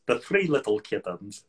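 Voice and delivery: put-on lightly done Eastern European villain accent; a quick mock Gru, only a hint of the accent; Gru impression. Guest showing the host the one voice he can do, a light Gru impression. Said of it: "Gru from Despicable Me, he just goes".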